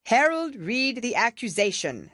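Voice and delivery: the king orders the herald. A narrator's reading. The king's voice.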